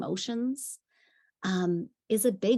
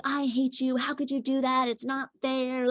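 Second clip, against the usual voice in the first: mock child yelling; 6 semitones higher than usual. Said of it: "yelling at me".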